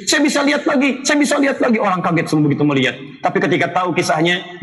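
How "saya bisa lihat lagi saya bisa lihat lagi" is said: high, elated repeated cry; healed man exclaims that he can see again; overjoyed cry. Retold or imitated. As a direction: call out in disbelieving joy. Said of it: "Dia teriak".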